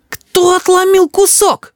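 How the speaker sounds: put-on very high, slow outraged child cry; child's yell. A narrator's reading. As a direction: cry out like an indignant little boy. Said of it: "закричал Рис".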